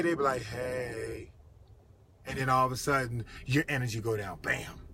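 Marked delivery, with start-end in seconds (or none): 0.4-1.3 low, drawn-out, downbeat "hey"; deflated reply. Retold or imitated. Someone on the phone draining the caller's energy, deflated. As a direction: sink into a droopy, energyless greeting.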